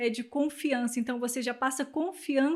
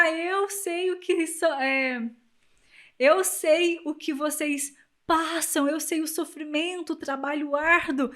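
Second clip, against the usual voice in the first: político do povão; 3 semitones higher, 5 dB louder than usual. Acted. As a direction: play a politician feeling the people's pain, louder on the opening. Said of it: "Ele tem que ser do povão". (político falando ao povão, arrastado)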